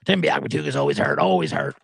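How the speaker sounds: very low, monotone sports-talk host; Scott Farrell impression. Retelling a host's hot take on an injured player, very low mimicry. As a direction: drop way down and drone it on one note. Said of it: "He said something like".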